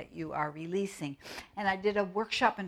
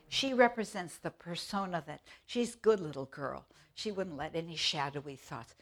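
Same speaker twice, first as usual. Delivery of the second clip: ordinary talk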